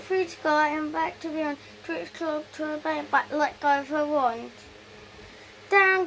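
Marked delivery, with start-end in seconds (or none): 5.7-6.1 a higher, louder down; loud cry. Acted. Richard calls the broom down to find the wand, loud cry. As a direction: call it down with urgency. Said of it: "cried Richard".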